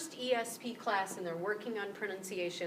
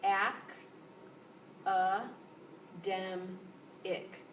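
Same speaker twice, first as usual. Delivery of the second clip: "ac, a, dem, ic" spoken slowly, one syllable at a time; slower than usual. Shown as a demonstration. Teacher breaks the word into syllables for students. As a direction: split "academic" into its four beats with pauses. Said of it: "this is the stress syllable".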